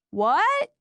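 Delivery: high call through cupped hands. A narrator's reading. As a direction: shout back across the driveway. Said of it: "he called".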